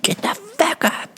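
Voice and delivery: high, slow, breathy imitation of a barked order; mock drill sergeant voice; imitated instructor bark; drill instructor yell.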